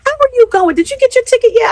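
put-on high, loud girlish friend voice; imitating friend. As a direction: voice the excited friend calling way up high. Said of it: "She's like".

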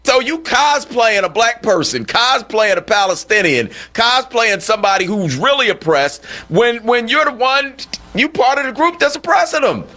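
high, indignant accusation; incredulous. Acted. Host calling out a woman cosplaying the oppressed, incredulous. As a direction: call out the pretence, pitch climbing at the end. Host indicts the absent pretender.

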